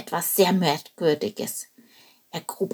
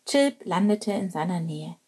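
ordinary delivery, nothing marked

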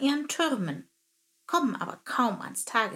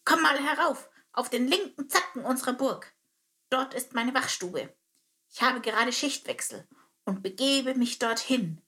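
higher soldier voice, briskly inviting; a captain calling the bird up to his guardroom; termite captain inviting; 4 semitones higher than usual. Acted.

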